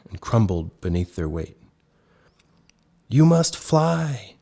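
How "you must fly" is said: very slow, high urgent bear cub; desperate growl. A narrator's reading. Snow Bear urges Ooga to fly, desperate. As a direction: push every word out in desperation. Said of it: "Snow Bear growled desperately".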